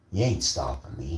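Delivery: ordinary talk, nothing marked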